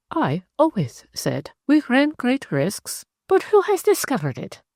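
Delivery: a cool Frenchwoman, voice lifted and unhurried; French lady. Acted. Narrator voices Zélie.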